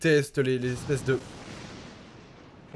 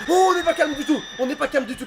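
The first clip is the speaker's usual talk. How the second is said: high, rattled cry; jolted by a scare; startled; 10 semitones higher, faster than usual. Real, not acted. Scared again.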